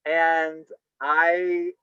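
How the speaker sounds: normal talking voice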